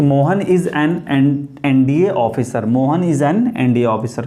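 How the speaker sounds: slow, word-by-word dictation of the answer. Shown as a demonstration. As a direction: spell the sentence out slowly for students. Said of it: "इसलिए यहाँ पे क्या लगाएंगे".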